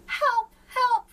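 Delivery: high, loud panicked yelling; frantic cry for help. A narrator's reading. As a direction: shout for help in alarm. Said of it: "cried the page".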